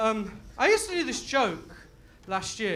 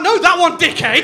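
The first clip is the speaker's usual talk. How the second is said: loud, higher, exasperated snap; retold exasperated yell; 6 semitones higher, 14 dB louder than usual. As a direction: yell it at the unhelpful stranger. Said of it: "I'm like".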